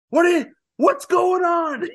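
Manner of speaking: a hungover pirate waking up confused; groggy Jack. Retold or imitated. Jack waking to find the rum burnt, slow and high. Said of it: "He's like".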